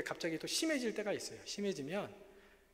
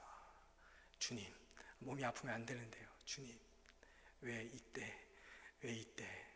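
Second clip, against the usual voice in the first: softer, lower murmured plea; hushed prayer; 4 semitones lower, 11 dB quieter, slower than usual. Retold or imitated. Pastor praying before the trip, hushed and softer. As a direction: whisper a worried complaint to God. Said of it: "이렇게 기도하면서 갔습니다".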